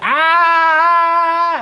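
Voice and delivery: a high, loud mock shriek; short imitated yelp; imitated pet scream. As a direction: squeal like the animal left alone. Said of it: "he's like".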